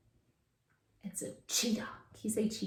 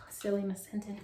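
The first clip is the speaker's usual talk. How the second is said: soft aside; 3 semitones lower, 7 dB quieter, faster than usual. Pretend. Teacher mocks herself softly.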